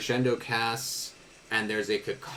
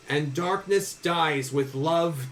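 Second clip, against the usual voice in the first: raised, slow shout, easing as it ends; Franz, mock shouting; 6 semitones higher, 3 dB louder, slower than usual. Franz to both wizards over deafening sound, restrained shouting. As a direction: call it out slowly over a din, held back from full volume. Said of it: "can barely hear Franz shouting to both of you".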